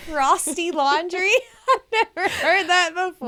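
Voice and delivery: high, loud, laughing incredulity; amused disbelief. Real, not acted. Co-host hearing an odd laundry phrase, laughing in disbelief. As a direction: burst out laughing in real surprise.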